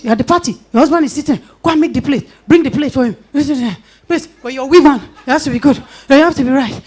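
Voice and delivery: hammed-up Nigerian aunty lecturing; mock party aunties. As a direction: imitate bossy aunties scolding a wife.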